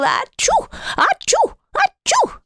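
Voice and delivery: big sneezes. Acted. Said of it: "the snowman sneezing as hard as he could".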